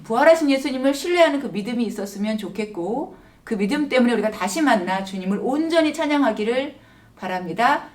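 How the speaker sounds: usual speaking voice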